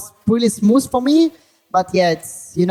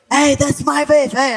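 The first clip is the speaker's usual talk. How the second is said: retold angry surfer's yell, pitched up; imitated yell; 7 semitones higher than usual. Surfer screaming at him over a wave, imitated yell. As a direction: call out high, mimicking a screaming surfer. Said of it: "starts screaming".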